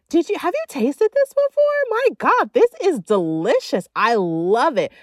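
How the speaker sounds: loud, high, over-the-top raving; hammed-up ecstatic foodie; gushing delight. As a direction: gush to the waiter as if food-struck. Acts raving to waiter about food.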